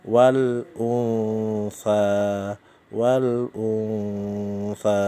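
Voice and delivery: "Wal unsa" recited very slowly, the n hummed into the s; Quran recitation. Shown as a demonstration. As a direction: chant "wal unsa" drawn out with a nasal hum. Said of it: "Yang nomor tiga ini". (lantunkan "wal unsa" sangat pelan dengan dengung)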